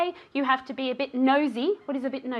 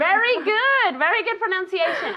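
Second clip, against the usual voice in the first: enthusiastic praise; 5 semitones higher, 4 dB louder than usual. Real, not acted. Teacher praising a student's pronunciation, enthusiastic. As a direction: praise with a big lift in pitch.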